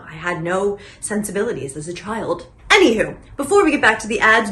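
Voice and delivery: normal talking voice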